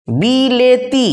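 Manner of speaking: বিলেতি very slowly, syllable by syllable; drawn-out syllables. Shown as a demonstration. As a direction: stretch বিলেতি as "bii-lee-tii". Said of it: "লয়ের সঙ্গে একার ছিল". (টেনে টেনে, ভেঙে ভেঙে বিলেতি)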